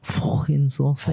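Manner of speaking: slow mock Liverpool accent, rising at the end; asked to do the Liverpool accent again; Scouse accent. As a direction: repeat the Scouse jibe on request. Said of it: "Do that one more time".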